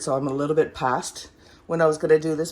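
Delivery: normal talking voice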